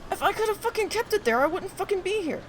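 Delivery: high-pitched, loud angry outburst; exasperated rant. Real, not acted. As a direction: spit it out in disbelief.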